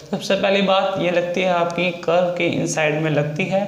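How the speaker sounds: normal talking voice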